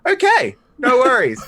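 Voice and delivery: high, loud, put-on okay; mock bemused agreement. Telling the gun-shop clerk okay, mock-bemused. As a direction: chirp it with fake calm.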